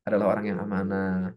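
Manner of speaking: low, subdued blessing; low, earnest supplication; silent-style prayer. Shown as a demonstration. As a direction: drop into a private prayer for a client. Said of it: "Kan kita bisa ngedoain".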